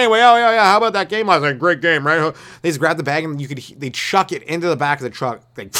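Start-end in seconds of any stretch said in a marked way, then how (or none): 0.0-2.3 loud, high casual banter; careless workers chatting. Retold or imitated. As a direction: guys laughing it off right after. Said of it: "they're just laughing".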